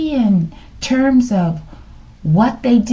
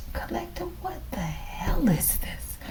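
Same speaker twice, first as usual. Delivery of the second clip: whisper; 7 semitones higher, 14 dB quieter than usual. Real, not acted. Shocked aside over card.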